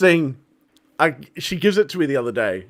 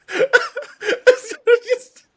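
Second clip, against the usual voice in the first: squeaky, high voice squeezed by laughing; real giggling strain on every word; helpless laughter; 13 semitones higher than usual. Real, not acted. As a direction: struggle to explain while cracking up. Cannot explain mop for laughing.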